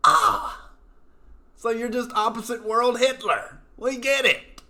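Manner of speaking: high, loud mocking outburst; mock exclamation. Real, not acted. Scoffing at indoctrinated students, high loud mock outburst. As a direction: burst out in mock realization.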